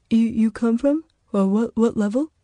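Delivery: halting, frightened questions; nervous stammer. Acted. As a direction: stumble over the words.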